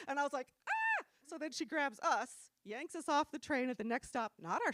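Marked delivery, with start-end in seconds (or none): 0.7-1.0 very high short squeal; mock squeal. Girl watches mom confront creeps, high mock squeal. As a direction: let out a tiny shocked shriek. Girl's startled squeal in story.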